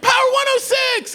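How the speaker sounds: very high, loud, rapid screech; piercing mock shriek; imitated party-girl yelling. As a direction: yell it like an over-hyped back-seat passenger. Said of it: "screaming and yelling".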